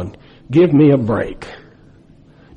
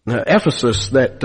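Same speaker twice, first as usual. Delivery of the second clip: ordinary delivery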